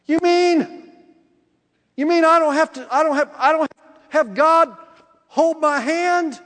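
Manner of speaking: high, loud, incredulous reaction; acted amazement. Preacher reacting to God's trust in him, amazed. Acts out amazed reply to God.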